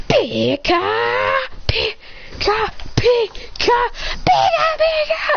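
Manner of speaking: high squeaky Pika chant; rising cute creature call; Pikachu voice. Acted. Charging like Pikachu.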